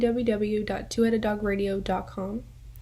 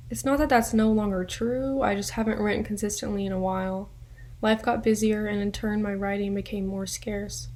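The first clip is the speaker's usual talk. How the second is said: ordinary talk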